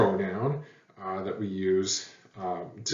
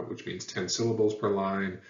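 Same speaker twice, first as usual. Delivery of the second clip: ordinary talk, nothing marked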